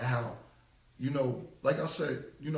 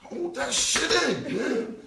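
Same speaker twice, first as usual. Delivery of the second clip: laughing plea; 7 semitones higher, 6 dB louder, much slower than usual. Retold or imitated. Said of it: "I'll be like".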